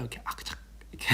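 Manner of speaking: hushed asmr ad whisper; whispered crunch. Pretend. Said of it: "저 딱 등장할까요?".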